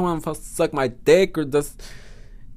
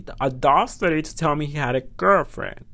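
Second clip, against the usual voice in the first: normal talking voice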